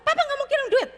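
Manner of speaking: high, loud stern refusal; father refusing to send money, loud and high; angry father. Retold or imitated. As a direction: refuse the money like a furious dad. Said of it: "kata si papanya".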